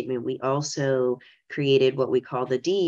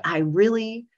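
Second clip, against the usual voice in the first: earnest plea, raised pitch and lifted energy; emphatic retold appeal, higher than usual; very serious, insistent; 5 semitones higher, 3 dB louder than usual. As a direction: press the point sincerely, with a small laugh near the end. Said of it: "I was very serious to say".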